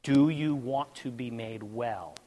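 slow, low, soft repeated question; voice of God. Retold or imitated. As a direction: put the question softly, unhurried and low. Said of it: "he asked me again".

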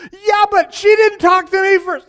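high, loud whining protest; husband protesting to his pastor, whining loud; whiny protest. Retold or imitated. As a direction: complain like a sulky husband. Ray protesting loudly.

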